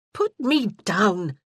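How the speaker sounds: slow, loud indignant protest; indignant demand. A narrator's reading. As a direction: demand it, half laughing. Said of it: "she demanded indignantly".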